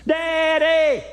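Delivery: put-on high, loud kid calling out; excited child shout. Little son shouting daddy at the airport, high and loud. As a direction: shout for daddy across the ramp.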